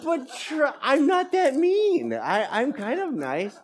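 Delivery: high, laughing self-defense; pleading his case with a laugh; protesting. Retold or imitated. Husband protesting to wife, high and laughing. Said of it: "I'm like".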